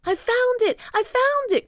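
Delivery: high, loud, repeated exclamation; excited cry. A narrator's reading. Jack announcing his find to Annie, crying excitedly. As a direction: cry out the discovery. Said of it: "cried Jack".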